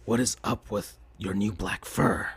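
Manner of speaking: a quiet sneering jab; Shadow's voice. Acted. Shadow teasing Sonic about his fur, low and soft.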